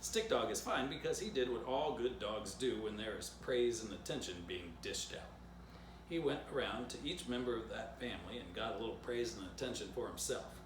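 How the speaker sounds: plain reading voice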